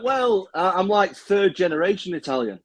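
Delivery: a hint of a sheepish American; imitating an American.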